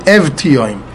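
Evtioim restated with sounded v and hard t; correct pronunciation. Shown as a demonstration. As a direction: say "Evtioim" as ev-tee-oym. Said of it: "you pronounce the Vav".

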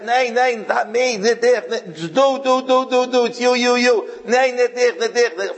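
high, loud pleading cry of innocence; frantic denial in Yiddish; desperate protest. Retold or imitated. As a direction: scream out your innocence. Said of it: "was screaming out".

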